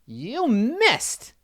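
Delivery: high-pitched mocking kid's jeer; taunting kid. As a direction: crow it like a gloating little kid. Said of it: "the kid laugh".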